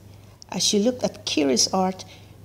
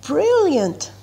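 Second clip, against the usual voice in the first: cheering; 6 semitones higher than usual. A narrator's reading. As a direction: cheer her on as she shines. Friends cheer at the art show.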